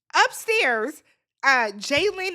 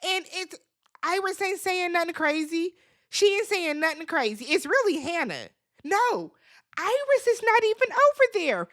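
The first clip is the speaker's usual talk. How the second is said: ordinary talk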